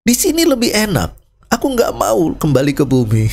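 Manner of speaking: high, whiny refusal; soul refusing to return to earth, whining; complaining soul. Retold or imitated. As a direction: protest to God like a sulking child. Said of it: "dia komplain".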